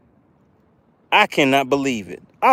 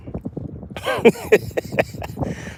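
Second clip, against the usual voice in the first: breathy laugh; 4 semitones higher, 6 dB quieter than usual. Real, not acted. Laughs after fish voice.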